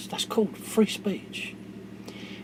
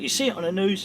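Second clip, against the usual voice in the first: ordinary talk, nothing marked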